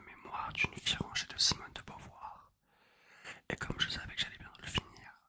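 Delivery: ordinary talk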